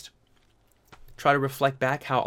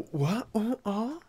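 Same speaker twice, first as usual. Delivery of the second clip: baffled; 10 semitones higher, 4 dB quieter, slower than usual. Retold or imitated. Driver finds no driver.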